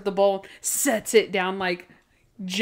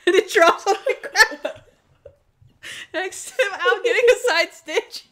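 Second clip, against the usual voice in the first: high-pitched, helpless laughing while talking; real laughter; 11 semitones higher, 4 dB louder than usual. Real, not acted. Podcaster retelling a disastrous show night, cracking up. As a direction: squeak the words out through laughter.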